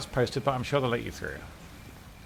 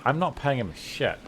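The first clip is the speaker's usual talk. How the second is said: ordinary talk, nothing marked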